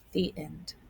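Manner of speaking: ordinary delivery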